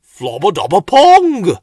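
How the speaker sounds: loud high earnest burble; explaining gibberish. A narrator's reading. Bill explaining the smell to Slowcoach, loud gibberish. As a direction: try hard to explain in gibberish. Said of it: "Bill tried to explain".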